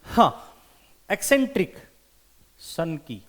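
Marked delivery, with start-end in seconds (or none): none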